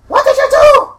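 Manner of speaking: very high, loud screech; acted shrieking. Gretchen confronts the orc, high screeched accusation. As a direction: screech the question at full volume. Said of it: "You hear something".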